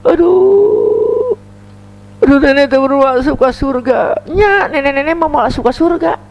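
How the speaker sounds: put-on high, wailing old woman's voice; tearful old woman lamenting to the Prophet; crying grandmother. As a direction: whimper it like a weeping granny.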